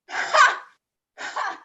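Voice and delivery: two breathy, derisive bursts; mocking laugh. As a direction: laugh contemptuously at the child. Beast laughs at the boy.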